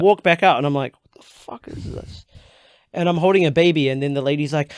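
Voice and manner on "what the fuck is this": breathy whisper, slow; whispered aside. Retold or imitated. Holding a toy baby on stage, he whispers in disbelief. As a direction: whisper it to yourself, baffled.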